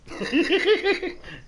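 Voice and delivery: high-pitched real giggle. Real, not acted. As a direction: let out a run of high giggles. Laughs after explaining himself.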